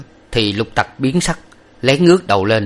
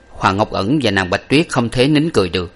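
usual speaking voice

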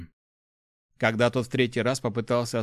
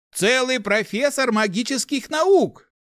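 high, loud, slow grand proclamation; theatrical; 13 semitones higher, 6 dB louder, slower than usual. A narrator's reading. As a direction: declaim it like a stage actor. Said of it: "театрально заявил он". (театрально, громко и высоко)

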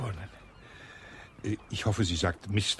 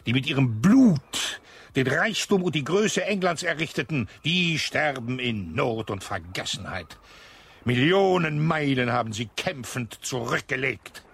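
louder, higher rant about ungrateful state; indignant outburst; 5 semitones higher, 5 dB louder than usual. Acted. Colonel rails at the widow about the state, indignant and louder. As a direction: rail against the state's ingratitude. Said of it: "dass ich mich errege".

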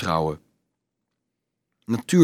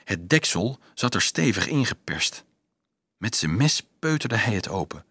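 usual speaking voice

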